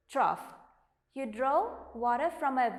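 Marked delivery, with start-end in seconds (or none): 0.1-0.4 "trough" low, as TROFF; model pronunciation. Shown as a demonstration. Teacher repeating how to read it, low model pronunciation. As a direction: say "trough" firmly, ending in an F. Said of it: "How do you read it?".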